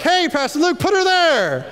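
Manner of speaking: high, laughing buddy voice; Nick's hearty greeting. Retold or imitated. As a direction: greet the pastor with a grin. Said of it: "He's always like".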